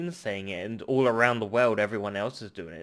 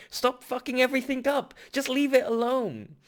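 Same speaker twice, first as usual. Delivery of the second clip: angry rant; 10 semitones higher, 4 dB louder than usual. Real, not acted. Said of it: "I'm sorry I'm getting angry".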